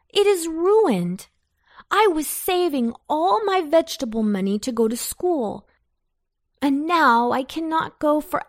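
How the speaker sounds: high, aggrieved complaint; lamenting. A narrator's reading. Antone tells Pumpkin of his ruined garden, lamenting.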